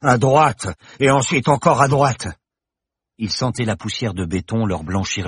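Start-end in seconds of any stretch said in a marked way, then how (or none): 0.0-2.3 hoarse, louder directions. Acted. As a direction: rasp out the directions. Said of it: "Roberto les guida d'une voix rauque". (voix rauque qui guide)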